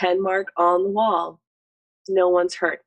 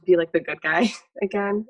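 ordinary talk

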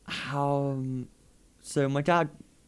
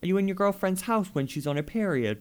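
mocking challenge to a preacher; 9 semitones higher than usual. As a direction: grill him like a smug accuser. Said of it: "and just go".

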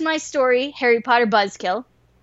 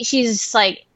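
usual speaking voice

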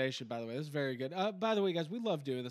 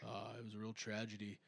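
Bret Hart impression; 7 semitones lower than usual. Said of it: "my Bret the Hitman Hart's a little bit more like this".

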